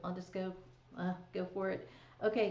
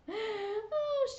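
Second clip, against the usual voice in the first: giggling; 13 semitones higher than usual. Retold or imitated. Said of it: "she giggles".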